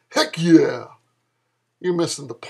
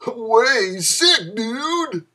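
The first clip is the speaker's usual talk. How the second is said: whooping with a laugh, higher; thrilled stoner cheer; excited; 6 semitones higher, 3 dB louder, much slower than usual. Acted. Dude thrilled by shocking news.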